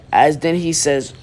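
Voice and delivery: ordinary delivery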